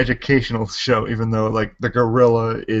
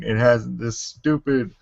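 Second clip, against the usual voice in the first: ordinary talk, nothing marked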